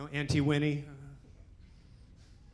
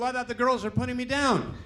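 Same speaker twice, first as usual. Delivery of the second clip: ordinary talk, nothing marked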